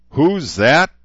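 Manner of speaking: loud, high shout rising at the end. A narrator's reading. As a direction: yell it in their faces. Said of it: "he shouted".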